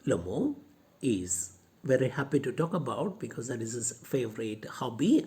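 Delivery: ordinary talk, nothing marked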